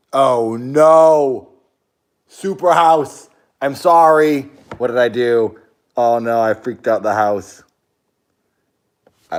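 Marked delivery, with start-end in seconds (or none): 0.1-7.6 loud, high cries of hammed-up dismay; mock panic. Streamer apologizing to Superhouse after freaking out the house, in mock panic. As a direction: plead loudly with the house in mock horror.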